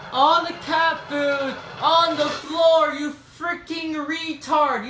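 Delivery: high, slow, loud scolding; furious high yell at the cat. Real, not acted. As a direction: shriek at the cat for the mess. Yelling at cat.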